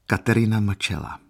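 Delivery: ordinary delivery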